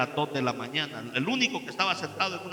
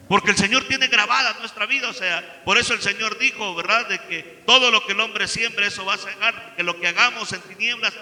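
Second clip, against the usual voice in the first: louder, higher preaching voice; preacher urging sincerity before God; fervent preaching; 5 semitones higher, 6 dB louder than usual. Acted. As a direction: press the warning home to the listeners. Preaching to the listeners.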